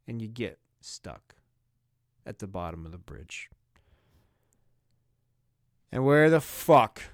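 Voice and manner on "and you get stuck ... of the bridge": hushed. Pretend. Grim punchline dropped low.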